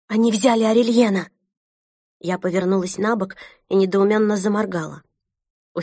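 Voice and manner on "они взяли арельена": frightened. Acted. Sister reports arrest in fear.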